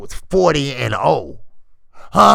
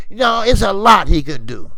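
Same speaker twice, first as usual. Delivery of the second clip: usual speaking voice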